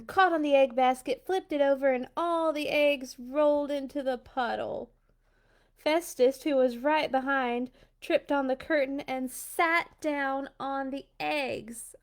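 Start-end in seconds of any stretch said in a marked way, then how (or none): none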